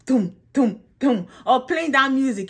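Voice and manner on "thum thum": punchy sung-out thumps; spaced, booming mouth-made beats; imitated boxing intro. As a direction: imitate a walk-in beat by mouth. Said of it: "they have those sound that come".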